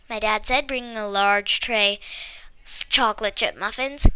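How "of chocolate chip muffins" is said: ordinary delivery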